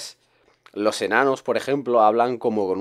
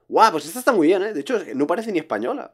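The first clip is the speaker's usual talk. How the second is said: gushing praise; 8 semitones higher, faster than usual. Retold or imitated. A friend imitating critics who gush that a film doesn't seem Spanish. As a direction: gush like a fan surprised it's Spanish.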